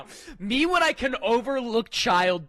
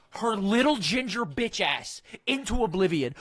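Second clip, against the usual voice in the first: ordinary talk, nothing marked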